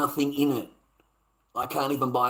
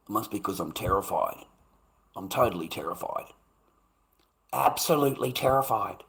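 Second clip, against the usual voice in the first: sarcastic terrified; 5 semitones lower, 5 dB quieter than usual. Retold or imitated. Sarcastic mock fear.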